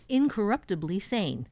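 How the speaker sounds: ordinary delivery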